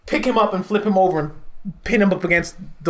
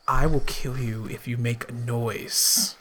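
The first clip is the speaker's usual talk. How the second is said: low, slow, hushed menace; cold warning under the breath; quiet threat; 8 semitones lower, 8 dB quieter, slower than usual. Acted. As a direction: threaten him softly and slowly. Private threat to intimidated captive.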